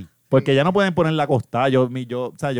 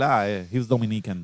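lightly voiced Siri, slow and softer in English; mock Siri voice; 6 semitones lower, 8 dB quieter, slower than usual. Joking about Siri, a soft slow mock Siri voice. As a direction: play the phone assistant catching his accent, slowly. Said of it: "porque Siri va a decir".

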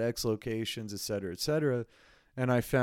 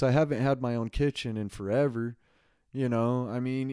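ordinary talk, nothing marked

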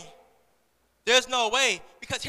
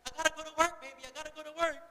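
higher, placating mother voice; imitated mom; 5 semitones higher than usual. Mom leaving for work to her little girl, imitated placating. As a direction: reason with a child in a higher voice. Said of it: "And she's like".

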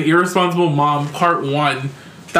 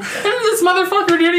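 high, laughing outrage; high-pitched laughing disbelief; 13 semitones higher than usual. Real, not acted. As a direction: laugh at how absurd it was.

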